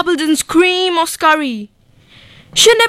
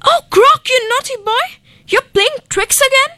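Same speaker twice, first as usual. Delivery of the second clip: much higher, fond scolding lady; lady scolding playfully; 11 semitones higher than usual. A narrator's reading. Lady scolds her pet crocodile, high and playful. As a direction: tut at a naughty pet, high. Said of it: "She cried".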